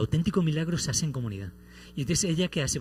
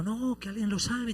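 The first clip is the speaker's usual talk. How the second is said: mock crowd cry; 9 semitones higher, 3 dB quieter than usual. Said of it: "todos gritando".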